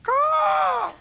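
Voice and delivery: high cawing bird call; raven caw. Retold or imitated. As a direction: squawk like a raven. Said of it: "They, they go".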